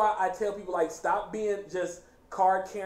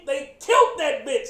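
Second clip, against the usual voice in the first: usual speaking voice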